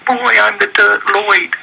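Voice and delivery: "Dirk Lloyd" said very high and slow; "Dirk Lloyd" squeaked out high and drawn slowly; Dark Lord voice, mangled. Retold or imitated. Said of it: "And it comes out".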